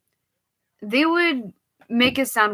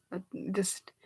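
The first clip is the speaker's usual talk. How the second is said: whisper; 14 dB quieter than usual. Real, not acted. Whispered aside before command.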